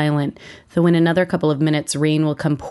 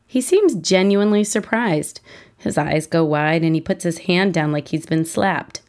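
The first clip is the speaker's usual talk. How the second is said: ordinary delivery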